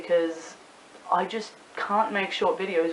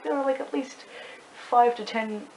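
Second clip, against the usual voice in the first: higher-pitched mock disbelief; incredulous retort; 6 semitones higher than usual. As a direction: retell it as a baffled, raised-pitch reply. Incredulous reply to retold comment.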